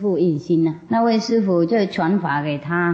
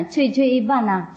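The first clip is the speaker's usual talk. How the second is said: normal talking voice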